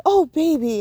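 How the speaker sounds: put-on match the child's upset feeling; sympathetic pacing voice. Shown as a demonstration. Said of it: "and you match them".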